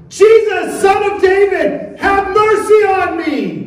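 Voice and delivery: high, drawn-out desperate shout; crying out. Acted. Blind beggar calling out to Jesus in hope, crying out. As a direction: call out at full voice for mercy. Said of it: "he called out to Jesus in hope".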